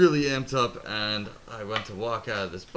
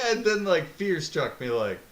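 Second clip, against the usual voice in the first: ordinary talk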